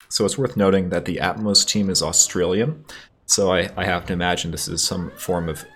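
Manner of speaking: ordinary talk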